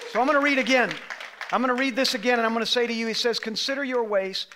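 loud, high, driving sermon voice; preaching at full force. Acted. Pastor rousing his congregation, preaching at full force. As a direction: press it on the whole room.

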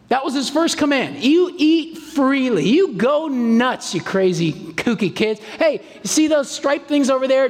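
put-on grinning parent urging kids to dig in; playful generous God. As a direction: cheer the kids on like a delighted dad. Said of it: "his command was this".

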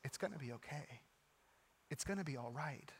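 low, very soft comforting promise; tender reassurance. Acted. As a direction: comfort someone just scooped up. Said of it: "and he says".

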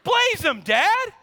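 put-on whine-shout it like an eager boy; pleading kid. Son begging dad to speed, mock pleading yell. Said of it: "He's like".

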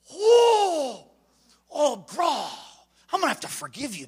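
acted struggle for self-control; straining to hold back anger. Gangbanger to kid who pushed him, strained restraint. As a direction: start with a high groan, then subside.

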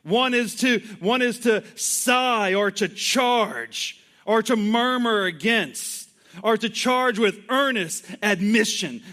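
usual speaking voice

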